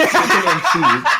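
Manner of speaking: repeating it through a high laugh; breaking into laughter on the words. Real, not acted. Friend laughing as he retells the order.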